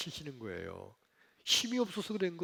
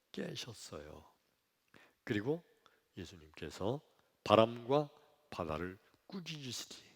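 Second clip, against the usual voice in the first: ordinary talk, nothing marked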